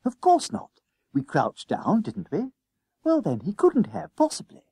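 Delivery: high, brisk-mannered schoolboy voice; Jennings, confidently dismissive. A narrator's reading. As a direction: brush off your friend's worry, pitched high.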